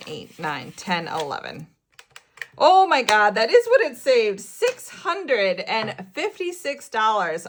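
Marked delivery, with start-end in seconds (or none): 2.6-7.5 loud, high burst of delight; excited. Real, not acted. Vlogger discovering her savings total, real excited outburst. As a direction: gasp it out in real joy. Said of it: "I am so excited right now".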